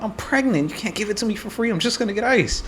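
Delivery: mock high-pitched woman pleading her case; complaining customer, imitated. Pregnant customer demanding a free cup, mock high complaint. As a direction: jump way up high for the mimicked customer. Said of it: "she just gets on some bullshit".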